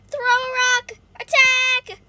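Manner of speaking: high, loud battle order; shouted command. Real, not acted. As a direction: yell the attack. Yelling attack commands.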